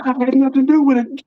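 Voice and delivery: high-pitched mock defensive plea; retold protest. Boy explaining the fish was not his doing, defensive protest.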